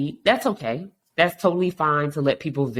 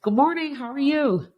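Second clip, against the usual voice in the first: much higher, sunny good-morning voice; bright cheery greeting; 13 semitones higher than usual. Retold or imitated. Host greets a coworker each morning, high cheery voice. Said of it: "It's like".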